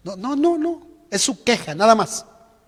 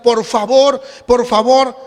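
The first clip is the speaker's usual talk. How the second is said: pleading church; 3 semitones higher, 7 dB louder, slower than usual. Retold or imitated. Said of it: "le dice a la iglesia".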